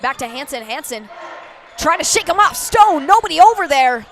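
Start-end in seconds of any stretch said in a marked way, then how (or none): 1.8-4.0 excited. Real, not acted. Live basketball play-by-play.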